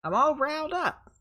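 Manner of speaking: high, loud Southern impression; Southern accent. Medium voicing the spirit for the listeners, in a Southern accent. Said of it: "hearing her with a Southern accent".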